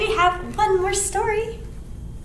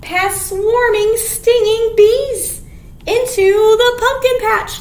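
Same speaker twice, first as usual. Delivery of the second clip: louder, slow, emphatic storybook reading; reading to children, building; 4 semitones higher, 5 dB louder, slower than usual. Acted. Teacher reading the chase scene to kids. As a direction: make the chase exciting. Reading picture book aloud.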